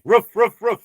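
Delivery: roof barked high and loud like a woof; dog's bark. Pretend. Showing what a dog does, mock barking. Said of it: "That's what a dog does".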